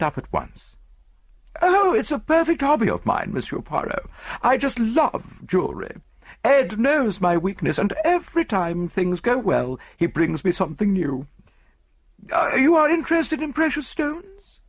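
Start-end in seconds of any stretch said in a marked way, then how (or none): 1.6-11.3 high-pitched society lady enthusing; gushing rich wife. Acted. 12.3-14.6 very high, rising lady's voice; curious society lady. Acted. Same lady questions detective.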